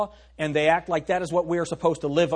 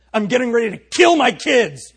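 higher and louder, a mom at the end of her rope; angry mom; 6 semitones higher, 9 dB louder than usual. Retold or imitated. Pastor's mom to visiting boy, fed-up mock-furious mother. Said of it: "That's what my mom said".

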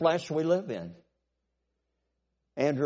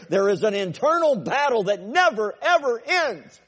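loud, high, driving sermon climb; full-force preaching; 9 semitones higher, 7 dB louder than usual. Acted. Preacher rousing the congregation about the flesh, loud and high. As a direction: preach it hard to the back row.

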